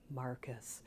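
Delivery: soft, low name; understated, almost whispered call; retold quiet greeting. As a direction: imitate a faint hello. Retold friend's weak greeting, arm lifted.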